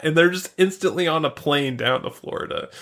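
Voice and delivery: usual speaking voice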